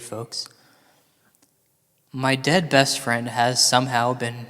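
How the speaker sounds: ordinary talk, nothing marked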